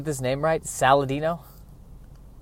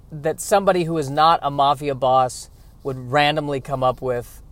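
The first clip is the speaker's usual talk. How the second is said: ordinary talk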